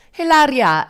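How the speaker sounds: Hilaria high and loud, mock-Spanish; hammed-up Spanish pronunciation of Hilaria; put-on Spanish accent. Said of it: "but nope".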